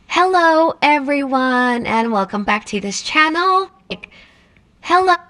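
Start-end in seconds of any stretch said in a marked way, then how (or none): none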